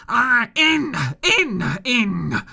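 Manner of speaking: high, loud furious shout. A narrator's reading. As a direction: drive them in with raging shouts. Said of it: "he shouted".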